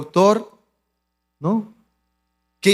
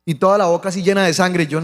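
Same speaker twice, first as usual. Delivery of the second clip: ordinary delivery, nothing marked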